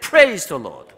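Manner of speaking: loud call for the congregation to echo; big, loud praise cue; shouted praise. Shown as a demonstration. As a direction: shout it for them to repeat. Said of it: "I would like to shout".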